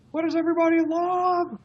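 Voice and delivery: very high imitation scream; Al Snow's scream. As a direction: call it out high to an imagined crowd. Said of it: "Al Snow would scream".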